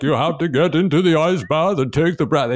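mimicking a Dutch breathwork coach in a Dutch accent. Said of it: "I started talking like a Dutch guy".

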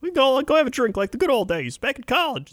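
very high pleading sitcom buddy; Ray Romano's friend. Acted. Pal begging Raymond out for a drink, high cartoonish voice. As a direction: coax him in a squeaky put-on voice. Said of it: "one of Ray Romano's friends".

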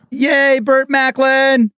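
high, drawn-out cheer; excited cheer. Real, not acted. Co-host cheering a favorite character's mention, excited cheer. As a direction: cheer it long and high with delight.

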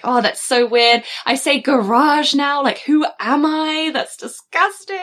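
slow, rising self-disgust; hammed-up disbelief climbing high; mock horror. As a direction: draw it out, appalled at yourself. Mock disgust at own new pronunciation.

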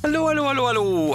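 high-pitched Irish lilt; Irish accent. Real, not acted. Host greeting his co-hosts, slipping into an Irish accent. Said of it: "I don't know why I went Irish there".

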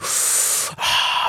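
one huge breath drawn in and let out; big inhale. Acted. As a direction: act out a deep gulp of air. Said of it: "took one big".

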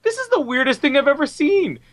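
high-pitched disbelief; incredulous. Real, not acted. Host reacting to a bizarre film scene, high and incredulous. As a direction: exclaim it high, baffled.

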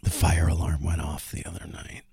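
soft conspiratorial whisper; hushed confession of a mishap. Real, not acted. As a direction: whisper it like a secret. Confiding dramatic mishap.

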